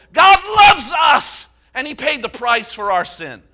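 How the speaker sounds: high, loud, rising to a shout; building preaching shout. Acted. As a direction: build to a roaring climax.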